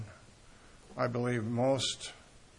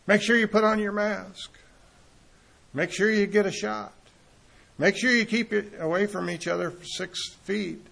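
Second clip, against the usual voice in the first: raised, mocking public-address call; sarcastic; 7 semitones higher, 5 dB louder than usual. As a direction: imitate a sarcastic bullhorn announcement, loud and high. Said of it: "he's being really sarcastic".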